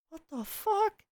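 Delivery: high, far-off shocked squeal; shocked cry. Real, not acted. Narrator finding the corpse, shocked squeal.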